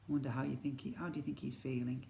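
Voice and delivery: ordinary delivery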